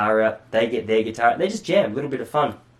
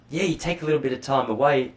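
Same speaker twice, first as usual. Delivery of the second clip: normal talking voice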